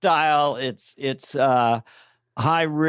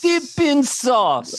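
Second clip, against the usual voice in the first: high, drawn-out mock rapture; hammed-up delight; 9 semitones higher, slower than usual. Host spoofing the sauce pitch, hammed-up delight. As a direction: stretch it out high and slow, savouring. Improvised tasting bit, mimicking pitch.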